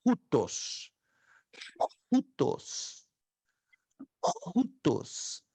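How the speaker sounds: careful Greek "hutos", rough breath before the u; teaching pronunciation. Shown as a demonstration. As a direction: say hutos with an aspirated h and OO. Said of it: "e se pronuncia".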